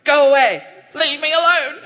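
loud high shout of refusal; loud high half-laughing shout; mock shout. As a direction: yell at the presence to leave. Shouting at God's presence.

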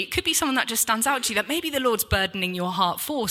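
ordinary delivery

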